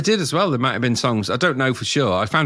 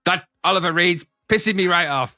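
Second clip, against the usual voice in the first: lightly voiced angry TV boss; mock annoyed boss, pitched up; Michael Grade impression; 8 semitones higher than usual. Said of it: "a really good impersonation of Michael Grade".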